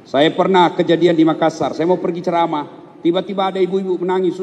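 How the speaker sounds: ordinary talk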